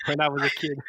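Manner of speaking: high squeaky laughing voice; being tickled. Retold or imitated. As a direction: giggle through the words. Retelling prisoner's childhood while tickled.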